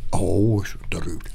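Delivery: breathy whispered mock pity. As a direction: whisper it like hushed sympathy. Mock sympathy.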